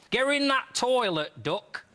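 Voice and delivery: a Nottingham lass ordering him into the loo; thick Nottingham accent. Acted. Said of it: "she said in her thick Nottingham accent".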